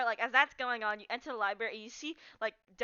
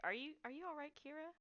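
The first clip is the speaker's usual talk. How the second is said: soft, higher-pitched careful check-in; gentle concern; 5 semitones higher, 11 dB quieter than usual. Acted. Hayden checks on a crying companion, gently concerned. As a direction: ask tenderly, keeping it very quiet.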